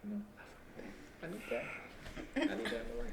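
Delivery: tipsy, soft and loose. Real, not acted. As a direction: slur softly like tipsy. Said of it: "He being drunk".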